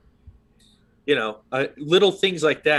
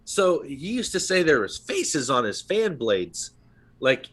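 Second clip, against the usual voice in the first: normal talking voice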